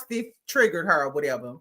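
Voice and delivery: ordinary delivery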